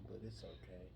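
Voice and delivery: hushed retold reassurance; soft, low aside; hushed confiding. Retold confiding reply to bystanders.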